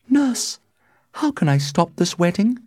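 high-pitched desperate appeal; crying out. A narrator's reading. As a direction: beg the nurse for a way out. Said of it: "she cried".

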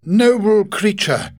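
exclaiming Godfrey. Acted. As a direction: burst out in admiration. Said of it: "he exclaimed".

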